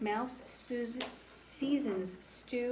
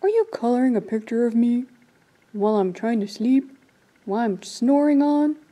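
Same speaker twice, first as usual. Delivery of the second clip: loud bear voice; grumpy bear puppet; 12 dB louder than usual. Acted. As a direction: boom it out like a woken bear.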